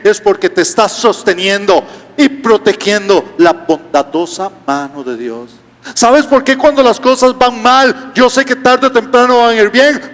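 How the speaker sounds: high, slow preaching build. Acted. Preacher exhorting the congregation, high and slow. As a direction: lift the congregation, rising and slowing.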